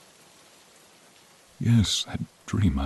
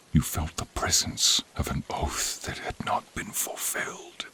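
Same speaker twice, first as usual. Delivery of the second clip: hushed, breathy whisper throughout; a fading creature's whisper; 6 dB quieter than usual. Acted. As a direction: speak only on breath, softly. Dying creature speaks softly.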